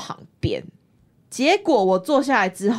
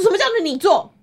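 higher, louder mock indignant demand; retold indignant retort; 10 semitones higher, 4 dB louder than usual. Angry stranger twisting her offer to sit, mock outrage. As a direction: throw his words back like an outraged stranger.

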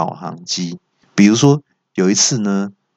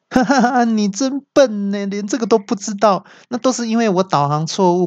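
high-pitched gloating navigator; hammed-up sneering ridicule; mocking laugh; 12 semitones higher than usual. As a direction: cackle then taunt in a high voice. Navigator laughingly mocks driver.

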